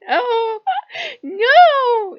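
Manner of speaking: very high, loud wailing no; desperate plea. Retold or imitated. Loki begging not to be kicked out, high wail. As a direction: wail it like you're being thrown out.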